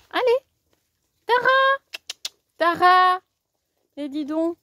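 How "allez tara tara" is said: high, loud calling; yelling across the room. Real, not acted. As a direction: call the dog from across the yard. Calling the dog.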